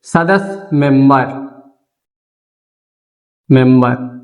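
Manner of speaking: slow, word by word dictation with long pauses; teacher dictating notes slowly. Shown as a demonstration. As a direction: dictate each word so they can write it down. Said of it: "note point में यह भी लिख लेना".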